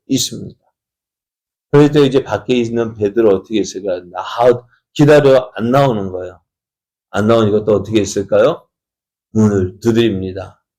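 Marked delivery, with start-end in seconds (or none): none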